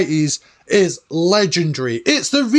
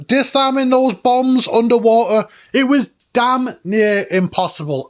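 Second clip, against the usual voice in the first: normal talking voice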